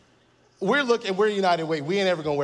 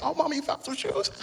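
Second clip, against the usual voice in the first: mock excitement; 10 semitones higher than usual. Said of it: "I was like".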